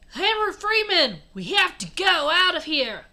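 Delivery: high, loud hammed-up warning; urgent guy. A narrator's reading. Guy warning Henry Freeman to go, urgent shout. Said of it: "a guy said to Henry Freeman".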